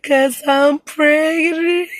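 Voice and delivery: high, slow, wailing through tears; sobbing confession. Retold or imitated. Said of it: "And I was like".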